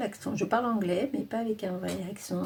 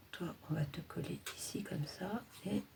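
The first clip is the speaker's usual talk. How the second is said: whisper; 9 dB quieter than usual. Real, not acted. Talking to a sticker while placing it.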